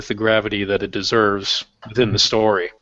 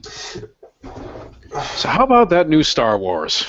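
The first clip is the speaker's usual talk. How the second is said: mock small talk; 5 semitones higher, much slower than usual.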